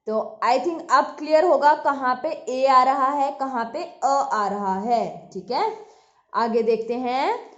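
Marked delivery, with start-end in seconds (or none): none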